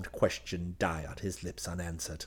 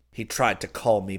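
usual speaking voice

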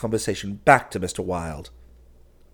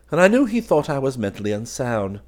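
usual speaking voice

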